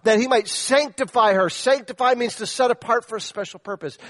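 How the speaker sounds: loud, high, pressing sermon delivery; full-force preaching. Acted. Pastor challenging husbands to give themselves up, preaching hard. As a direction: push every question at the husbands.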